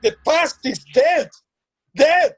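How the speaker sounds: bitter outburst. Acted. Father silencing the housekeeper's memories, rising anger. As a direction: slam the door on the past. Father cuts off talk of old days.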